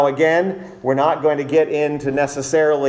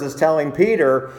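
usual speaking voice

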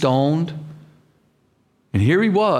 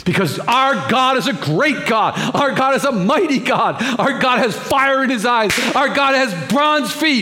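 preaching scream; 7 semitones higher than usual. Real, not acted. Pastor praising God to the congregation, screaming. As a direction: shout it out, climbing higher each line. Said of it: "Oh, I'm screaming".

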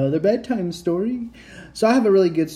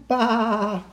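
long baa, pitched up; lamb bleat; 8 semitones higher than usual. Acted. Storyteller voicing the baa knit back into lamb, raised bleat.

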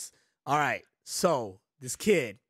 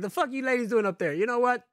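higher, louder scornful jeer; mocking heckle; 6 semitones higher, 4 dB louder than usual. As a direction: sneer at them that they deserve to fall.

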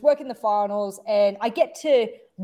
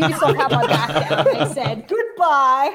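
laughing; 5 semitones higher, slower than usual. Real, not acted. Laughing joke about a banned player.

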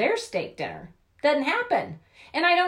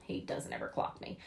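very soft, low disclaimer; hushed aside; 13 dB quieter than usual. Real, not acted. Reassuring viewers about her husband, hushed aside. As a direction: drop to a near-whisper to take it back.

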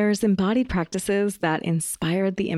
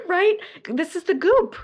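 much higher, louder gleeful exclaim; excited laughing agreement; 13 semitones higher, 4 dB louder than usual. Real, not acted. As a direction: burst in delighted at the shared image. Host laughing agreement over metaphor.

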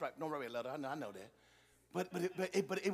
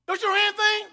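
usual speaking voice

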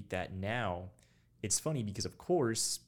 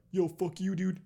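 giraffe guy voice; 10 semitones higher, 4 dB louder than usual. Retold or imitated. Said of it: "be like".

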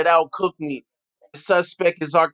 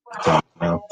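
surprised gasp; 9 semitones lower than usual. Real, not acted. Reacting to Jay Mills name.

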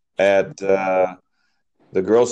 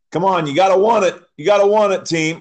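higher, pushy cheer from the stands; mock fan yell; 8 semitones higher than usual. Cohost imitating a fan urging the team, a mild mock yell. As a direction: egg the team on like a fan in the bleachers. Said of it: "when they yell to people".

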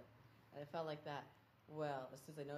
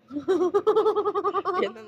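high, loud words squeezed through a laugh; laughing hard; 21 dB louder than usual. Real, not acted. Friend retelling the mushroom guy story, cracking up with laughter. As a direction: barely get the words out for laughing.

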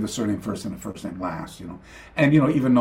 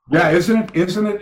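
excited; 7 semitones higher, 6 dB louder than usual. Real, not acted. Excited about AI photo.

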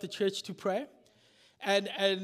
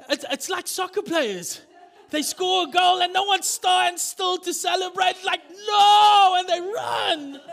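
excited shout; 9 semitones higher, 10 dB louder than usual. Acted. Soccer players celebrating goal.

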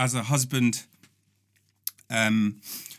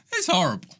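normal talking voice

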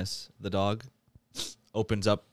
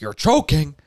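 loud insistence; 7 semitones higher, 8 dB louder than usual. Acted. Pistachio insists during the Heimlich.

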